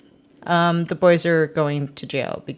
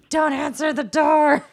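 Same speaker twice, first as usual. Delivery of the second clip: panicked plea; 9 semitones higher, slower than usual. Retold or imitated. Brothers panicking about the cop.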